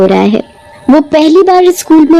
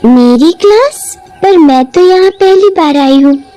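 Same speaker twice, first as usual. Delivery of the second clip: put-on puzzled girl; 6 semitones higher than usual. Girl confused at reception.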